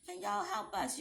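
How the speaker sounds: retold begging. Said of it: "too proud to beg".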